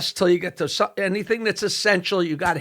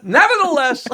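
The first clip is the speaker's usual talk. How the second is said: nevertheless; 7 semitones higher than usual. Retold or imitated. Announcer's unruffled punchline.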